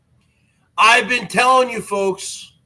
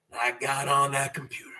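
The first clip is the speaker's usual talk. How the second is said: softer, quick confiding line; hushed; 8 semitones lower, 12 dB quieter, faster than usual. Acted. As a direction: drop it low and fast, like a secret. First take, hushed.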